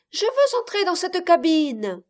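put-on high, loud child's voice; demanding little girl. A narrator's reading. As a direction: insist like a spoilt eight-year-old. Child demands to enter cabin. (voix de fillette capricieuse)

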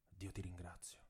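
even fainter repeat, almost gone; faint thread of voice. A narrator's reading. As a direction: let the thanks fade out. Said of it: "con un filo di voce".

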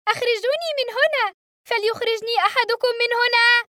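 very high, loud cry for help; panicked scream. A narrator's reading. Trapped boy begging to be let off the plane, screaming. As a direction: scream to be let out, pounding the door. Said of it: "وأنا أصرخ".

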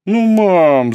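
put-on high, loud childish whine; whining. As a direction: whine like a sulky teen. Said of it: "заныла рыжая".

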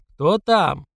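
crackly intercom voice; distorted speaker voice. Acted. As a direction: voice through a bad speaker.

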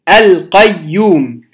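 القيوم drawn out, with a clear ل before the ق; إظهار اللام. Shown as a demonstration. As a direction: say "القيوم" slowly, every sound distinct, the ل sounded. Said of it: "نظهر اللام الساكنة". (القيوم ببطء مع إظهار اللام)

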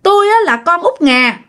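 put-on a dead girl's ghost speaking through the woman, high and insistent; possessed woman's voice. Possessed woman tells the mother who she is, in a high ghost voice. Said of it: "Mới nói rằng hả".

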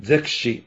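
زكشي with z in place of d; Sous pronunciation. Shown as a demonstration. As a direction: say "zekshi", opening زكشي on a buzzed z. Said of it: "بدل أن يقول د".

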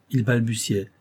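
ordinary delivery, nothing marked

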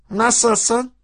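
spring a pointed hello on a friend; breezy greeting. A narrator's reading. Real Sacit's greeting after kick. (tiz sesle hal hatır sorma)

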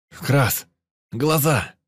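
loud, high warning cry; warning shout. Acted. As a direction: yell to cover your eyes. Warning shout during firefight.

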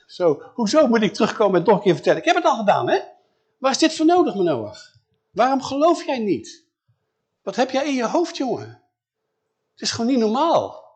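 high, loud, fed-up rant; an angel sick of repeating himself, voice rising; exasperated angel. Acted. Angel annoyed at repeating message.

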